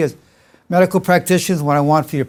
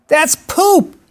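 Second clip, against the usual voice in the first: high-pitched mock alarm; upset son; 12 semitones higher, 3 dB louder than usual. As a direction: exclaim it high, imitating a horrified son. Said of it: "how upset he was".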